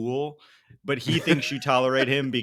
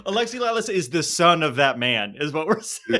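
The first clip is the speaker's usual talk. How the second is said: real laughter breaking into the words; laughing; 4 semitones higher than usual. Real, not acted. Host cracking up at own joke about Lalas, laughing. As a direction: get it out through the giggles.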